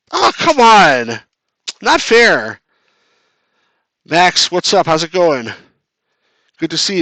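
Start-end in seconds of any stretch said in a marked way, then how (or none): 0.1-2.6 loud, high, drawn-out protest; real exasperated whine. Real, not acted. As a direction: cry foul at a game loss. Losing badly in the game.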